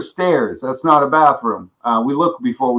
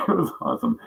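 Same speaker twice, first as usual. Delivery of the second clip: laughing; 4 semitones higher, 8 dB quieter, much faster than usual. Real, not acted. Laughing repeat.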